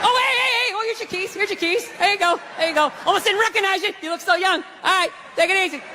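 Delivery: high, chatty cover-up; falsely friendly surgeon. Acted. Surgeon seeing off a botched patient, falsely cheerful. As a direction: greet and usher out with forced cheer.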